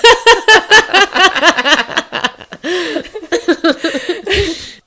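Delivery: big high burst of laughter; loud laugh. Real, not acted. Big laugh after joke.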